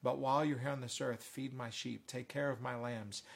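low, soft, gentle reassurance; hushed voice of a forgiving teacher; Jesus reassuring. Acted. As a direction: entrust the task quietly and tenderly. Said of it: "And Jesus says".

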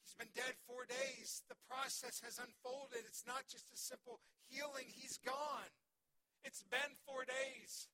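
insistent sermon emphasis; building preaching. Acted. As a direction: preach it with rising urgency. Preaching the death of Lazarus.

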